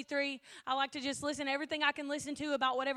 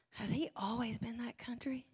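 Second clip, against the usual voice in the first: a hushed, breathy aside to a friend; whispered aside; 3 semitones lower, 8 dB quieter than usual. Retold or imitated. Whispering to Jordan at the funeral about Matt's accent, hushed. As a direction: lean in and whisper the question. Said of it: "I was like".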